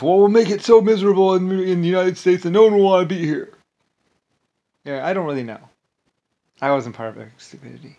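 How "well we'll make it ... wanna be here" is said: loud, raised mock whine of someone's idea; scornful, high-pitched mimicry; mocking quote. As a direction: parrot their idea back loudly, pitched up. Mock-quotes Rick Bush's idea.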